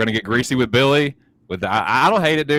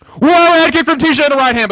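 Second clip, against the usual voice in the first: much higher and louder, sudden burst; excited shout; 8 dB louder than usual. Real, not acted. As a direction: yell it as the kick lands. Sudden head kick in fight.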